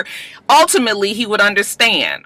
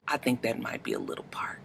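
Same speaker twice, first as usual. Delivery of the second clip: whispered aside; 9 semitones lower, 18 dB quieter, faster than usual. Real, not acted. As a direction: drop to a secret whisper.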